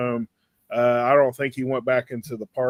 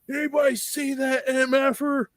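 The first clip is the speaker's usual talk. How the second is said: imitated yelling; 12 semitones higher than usual. Furious biker demands answers from the bystanders, imitated yelling. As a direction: retell an irate man's yell in a much higher voice. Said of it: "he climbs out and he's yelling".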